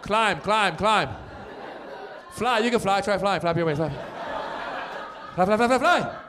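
high, urging voice, laughing as it goes; coaching the kids. Retold or imitated. Parent urging a young kid to run and fly, high and laughing. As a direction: egg the toddler on to wear him out. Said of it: "you're like".

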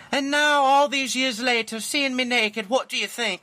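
high-pitched Scottish wife; Scottish accent. Acted. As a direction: reminisce as a hammed-up Scottish bride. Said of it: "he does it in a Scottish accent".

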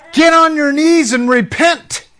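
high, loud command. Retold or imitated. The voice commanding the mafia man to repent, loud command. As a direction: order him down to his knees.

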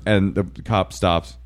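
usual speaking voice

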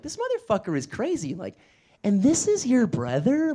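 mock-shocked customer; incredulous mom.

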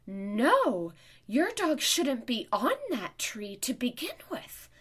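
high, loud scolding; mock outraged telling-off; retold angry yelling. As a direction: snap it out loud, imitating an angry stranger. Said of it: "her sheer anger just projecting at me".

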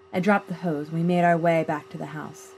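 plain reading voice

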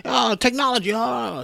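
put-on high, strained cranky old-man rant; old man yelling. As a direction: rail at everything like a crazed old man. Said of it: "like an old man yelling at everything".

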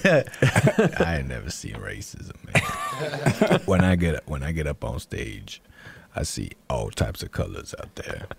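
low, mumbled rapper impression; slow start, then sinking low and quiet. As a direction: do a drowsy rapper talking, trailing down soft.

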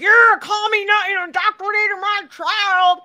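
high, loud mock outrage; hammed-up shouting angry parent; gangbusters. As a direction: storm in yelling, way over the top. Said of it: "Don't go in there all gangbusters".

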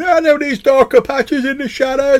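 shrill cartoon of a jittery dwarf; mocking Leopold intruding. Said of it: "this voice going".

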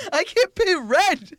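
high, slow and laughing; laughing mock whine. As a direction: drag it out giggling. Echoing joke through laughter.

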